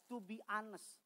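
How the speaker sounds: normal talking voice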